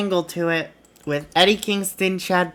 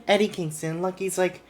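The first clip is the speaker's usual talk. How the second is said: ordinary delivery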